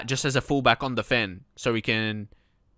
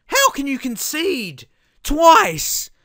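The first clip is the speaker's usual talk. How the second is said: high, slow, loud disbelief; exasperated yell; 12 semitones higher, 6 dB louder, much slower than usual. Real, not acted. Gamer berating his defenders, slow exasperated yell. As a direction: drag it out in angry disbelief. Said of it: "I'm just talking to my defense".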